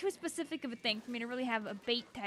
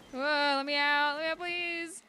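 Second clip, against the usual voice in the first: mock pleading; 3 dB louder than usual. Trapped in game, pleading.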